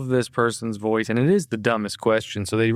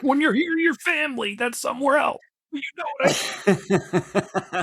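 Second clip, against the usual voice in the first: high cheesy ad voice; mock restaurant slogan; ad parody; 13 semitones higher than usual.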